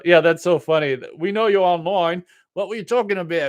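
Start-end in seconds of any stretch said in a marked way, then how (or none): 1.2-3.5 high-pitched hammed-up Aussie caller; badly faked Australian accent, pitched way up; mock Australian accent. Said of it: "Am I doing the right Australian accent".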